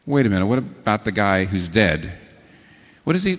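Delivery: ordinary talk, nothing marked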